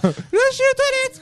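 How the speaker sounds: put-on high falsetto old lady voice; squeaky fake old mother; imitating his mother. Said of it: "il imitait la voix de sa mère". (voix de vieille dame imitée, aiguë)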